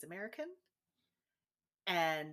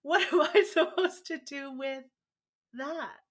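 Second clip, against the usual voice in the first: higher and louder, caught off guard with a laugh; flustered surprise; 8 semitones higher, 12 dB louder than usual. Retold or imitated. Retelling her reaction to her parents' new identity, mock flustered. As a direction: play it thrown, half-laughing at yourself. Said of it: "I was like".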